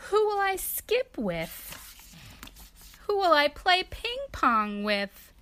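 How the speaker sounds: high, slow whimpering questions; whiny lament. A narrator's reading. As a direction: plead sadly as the friend leaves. Elephant laments losing friend.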